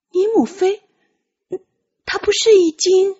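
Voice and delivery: high, uncertain question; hesitant young voice. Acted. Tian Qi asks Ji Zheng about his mother, hesitantly. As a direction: ask carefully, afraid to say it.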